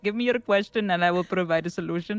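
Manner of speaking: put-on tech support call in an Indian accent; chirpy helpline agent, Indian accent. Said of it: "why am I so good at the Indian accent?".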